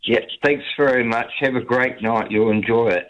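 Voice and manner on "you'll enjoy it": ordinary talk, nothing marked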